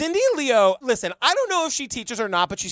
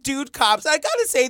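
ordinary delivery

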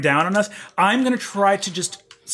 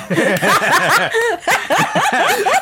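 hearty laugh; 13 semitones higher, 4 dB louder than usual. Real, not acted. Player laughs after bit.

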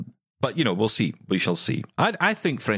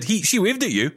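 high-pitched quote of a mother; imitating his mum; 10 semitones higher than usual. Mother telling her child about the singer, high imitation. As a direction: lift it high, as mum reporting the news. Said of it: "Just like that".

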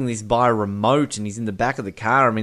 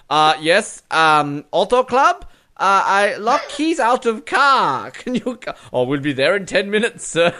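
high, hammed-up foreign henchman voice; halting put-on character voice, pitched up and loud; Stamper; 7 semitones higher, 3 dB louder than usual. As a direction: play an embarrassed henchman phoning for roadside help. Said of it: "like, Stamper going".

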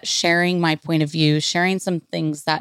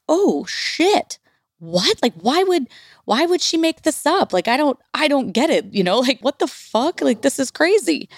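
stunned disbelief; 9 semitones higher than usual. Retold or imitated. Reacting to the nanny's news, higher rattled disbelief. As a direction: reel at the news, voice up high. Retold disbelief on learning truth.

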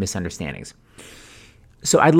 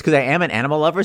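ordinary talk

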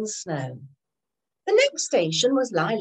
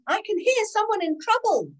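urgent; 10 semitones higher than usual. A narrator's reading. Driver urgently explaining.

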